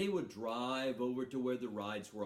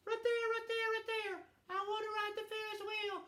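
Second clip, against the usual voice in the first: put-on much higher, squeaky excited little boy; little brother. Storyteller imitating little brother Joe, much higher and eager. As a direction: pipe up like an eager six-year-old. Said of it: "he's going".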